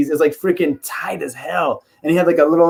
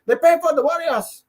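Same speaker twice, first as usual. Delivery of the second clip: very high accented fighter imitation; Yoel Romero; 12 semitones higher than usual. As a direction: pipe it up high, Warriors as "wah-ree-os". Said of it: "he can't say Warriors".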